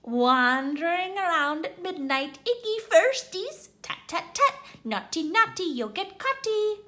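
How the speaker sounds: high, loud taunting sing-song; cackling Peeves taunting the students; gleeful poltergeist. Acted. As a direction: tease the first-years with glee. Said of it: "Peeves cackled".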